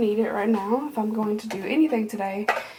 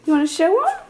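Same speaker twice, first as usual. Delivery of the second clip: talking up to a cat; pet talk; 11 semitones higher, 7 dB louder than usual. Real, not acted. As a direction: coax the pet up high and bright. Coaxing her pet.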